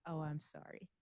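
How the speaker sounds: ordinary delivery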